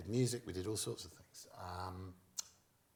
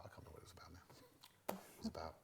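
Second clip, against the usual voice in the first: whispered aside; hushed aside; 5 semitones lower, 13 dB quieter than usual. Real, not acted. Comic whispering an aside about the forgotten song. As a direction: drop to a whisper, half to yourself.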